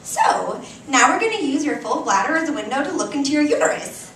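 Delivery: fast, loud and bright-voiced explaining; peppy technician. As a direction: rattle it off like a cheerful technician. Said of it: "a peppy woman with pink scrubs".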